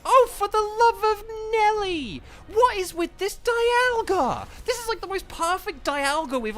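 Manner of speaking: real gamer's exasperation at full pitch; exasperated outburst. Real, not acted. Exasperated by the opponent's Dialga.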